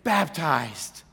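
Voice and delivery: lightly voiced tearful joy; Sandy's excited confession. Sandy telling the pastor her life changed, eager. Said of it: "And she says".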